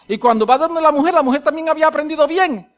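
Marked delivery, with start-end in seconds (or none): none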